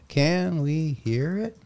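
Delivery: slow, high robot voice. Shown as a demonstration. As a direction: read it out like a robot. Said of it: "in Robot [name] voice".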